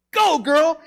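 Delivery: high-pitched loud yell; urgent shouting. Acted. Father rushing family downstairs before a tornado, urgent shouting. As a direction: holler to get everyone moving. Acts out urging daughter downstairs.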